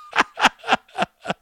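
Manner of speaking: very high-pitched laugh; real laugh. Real, not acted. Comic cracking up at his own story, high real laugh. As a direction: burst into high, helpless laughter.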